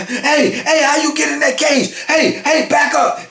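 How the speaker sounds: police calling into the cage to stop the fight; guards yelling. Retold or imitated. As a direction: bark it like panicked prison guards. Guards shouting at Marty in cage.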